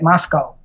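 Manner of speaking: second syllable of Moscow rhymes with "go"; Moscow ending in "oh". Shown as a demonstration. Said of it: "which is the way they say it".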